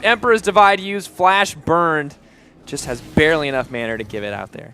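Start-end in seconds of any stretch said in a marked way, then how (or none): 0.0-2.2 rising, loud, higher hype call; excited commentary. Real, not acted. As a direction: build to a roaring call. Hype play-by-play.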